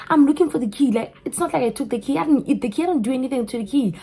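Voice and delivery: loud, high-pitched indignant protest; heated self-defence. Retold or imitated. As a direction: retell arguing back, exasperated. Retold quarrel, defending herself.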